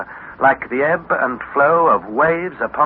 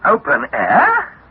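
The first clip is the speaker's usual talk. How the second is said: startled echo; 8 semitones higher, 3 dB louder, much slower than usual. Acted. Harry startled by uncle's warning.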